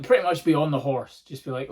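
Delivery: ordinary talk, nothing marked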